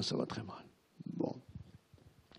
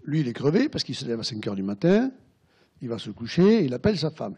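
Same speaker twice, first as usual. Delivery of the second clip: ordinary talk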